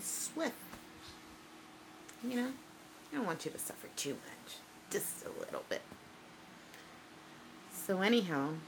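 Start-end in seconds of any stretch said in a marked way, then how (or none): 3.1-5.8 whispering a sinister warning to her rival; menacing whisper. Acted. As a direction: breathe the threat out softly. Menacing threat to opponent.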